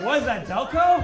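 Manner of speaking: loud joking question. Retold or imitated. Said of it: "I was like".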